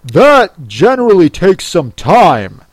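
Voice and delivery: loud, swooping Gryphon voice; interrupting. A narrator's reading. Gryphon interrupts the Mock Turtle, loud. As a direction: cut in loudly with big pitch swoops. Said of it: "interrupted the Gryphon".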